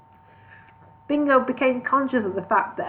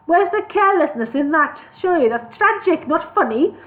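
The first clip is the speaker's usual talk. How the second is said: higher, louder outraged dwarf; indignant dwarf; 6 semitones higher, 5 dB louder than usual. Acted. Dwarf outraged at elf's joke, high and loud. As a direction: protest the insult hotly.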